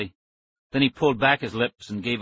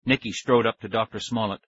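usual speaking voice

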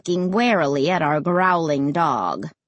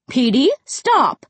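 raised, drawn-out command; yelling; 4 semitones higher, 5 dB louder, slower than usual. A narrator's reading. Mom to the barking dog, yelling. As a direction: yell slowly and firmly at a dog. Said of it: "Mom yelled".

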